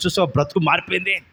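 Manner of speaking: ordinary talk, nothing marked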